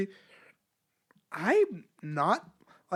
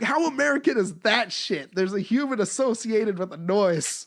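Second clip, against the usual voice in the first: a bit higher and louder, mock amazed; comedian quote; 5 semitones higher, 5 dB louder than usual. Retelling Rogan's joke, lightly voiced amazement. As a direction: play up the disbelief. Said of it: "He's like".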